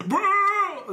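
high loud yelled bro; frat bro shout. Retold or imitated. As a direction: belt out a frat bro call. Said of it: "That was Nick. He said bro".

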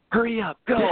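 high mock bark; mild mock order from a controlling father; imitating impatient dad. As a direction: rush them like a bossy dad. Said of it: "reminds me just like my dad".

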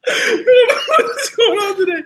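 squeaky giggling through words; cracking up mid-sentence; laughing. Real, not acted. Laughing at own joke.

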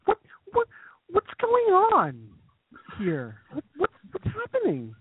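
high, slow, fretful mom voice; impression of mom. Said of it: "an impression of your mom".

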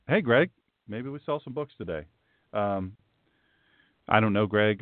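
normal talking voice

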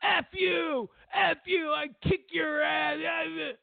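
high, tearful mock yell; hammed-up tantrum, voice cracking high; mock screaming kid. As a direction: scream it like a bratty child who just lost a fight. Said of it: "you start screaming".

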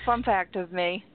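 usual speaking voice

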